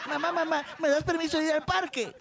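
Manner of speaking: put-on high-pitched kid asking mom; a child begging for permission, pitched well up; little kid's high, eager plea; pleading child. Joke's child asks mother permission.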